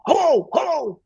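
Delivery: high, loud American yelling into a phone. Retold or imitated. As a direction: shout down a bad line in an American accent. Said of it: "I heard this American voice going".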